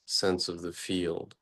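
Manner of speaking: normal talking voice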